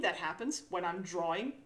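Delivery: ordinary talk, nothing marked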